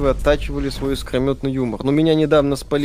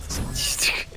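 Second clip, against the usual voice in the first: whisper; 6 dB quieter, slower than usual. Pretend. Echoes the word in whisper.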